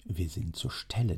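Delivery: a quick knowing whisper; hushed aside to yourself; whispered thought. Acted. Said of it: "dachte Herr Preis".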